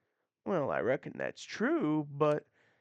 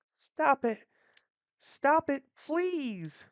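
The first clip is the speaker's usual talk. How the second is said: high, pleading cry of stop it; frightened pleading; 9 semitones higher, slower than usual. Acted. Woman begging the attackers to stop, high and frightened. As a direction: beg them to stop, voice climbing high.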